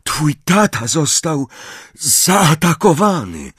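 gasped out slowly, short of breath; panting. A narrator's reading. Harry gasping to Ron about his father, breathless. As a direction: force the words out between breaths. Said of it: "wydyszał".

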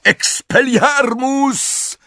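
high, long-drawn battle cry; shouted spell. A narrator's reading. Harry seizing his chance to disarm Goyle, shouted spell. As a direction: stretch the incantation into a high shout. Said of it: "krzyknął".

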